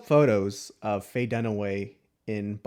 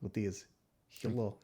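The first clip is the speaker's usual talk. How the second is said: put-on Russian accent; 7 dB quieter, slower than usual. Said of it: "Can you do a Russian accent?".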